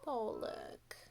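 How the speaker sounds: whisper. Real, not acted. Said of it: "whispered aside to children".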